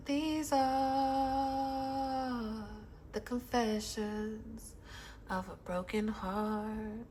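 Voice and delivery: very soft and slow, drawn out; hushed dramatic intro. Acted. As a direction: announce it like a sad film title. Dramatic title recitation.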